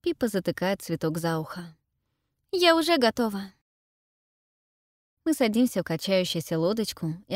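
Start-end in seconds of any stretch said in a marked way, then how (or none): 2.5-3.5 put-on girl's voice. A narrator's reading. Pippa voiced by narrator.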